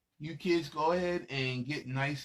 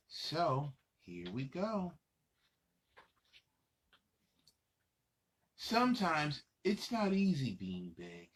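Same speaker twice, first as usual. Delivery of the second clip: put-on lively read-aloud for little kids; reading to children; 3 semitones higher, slower than usual. Adult reading a picture book to young children, lively storytelling. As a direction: read it out bright and animated for the kids.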